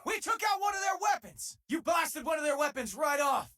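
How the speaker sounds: high, triumphant shout over gunfire; excited battle shout. Acted. Crew member shouting to the captain mid-battle, triumphant. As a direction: yell the good news across the fight.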